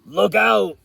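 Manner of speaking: loud, high, croaky warning shout; croaking toad cry. Acted. Toad warns the speeding car, croaky shout. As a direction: croak out a sudden alarm. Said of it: "croaked Toad".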